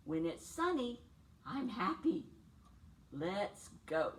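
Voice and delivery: usual speaking voice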